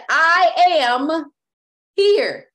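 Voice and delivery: high, loud call to the Lord; crying out. Acted. Peter calling out to the Lord, loud and high. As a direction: cry out across the water.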